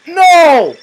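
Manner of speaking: loud, high yell; real howl of dismay; frustrated shout. Real, not acted. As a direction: cry out in protest at the game. Failure in game, shouted.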